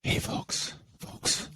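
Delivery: hushed, whispery presidential impression; Joe Biden. Host channels the president for a second, whispering impression. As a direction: whisper it softly to the folks. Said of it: "can I channel Joe Biden".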